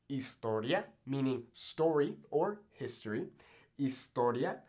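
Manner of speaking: historia with no H, starting on the I; silent H. Shown as a demonstration. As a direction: drop the H in historia. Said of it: "I'm not hearing this H".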